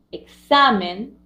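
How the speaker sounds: "examen" drawn out slowly, force on SA; stress on middle syllable. Shown as a demonstration. Teacher showing where voice force falls, "examen" slowly stressed on SA. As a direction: lean on the SA in "examen". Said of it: "Donde pongo mayor fuerza de voz".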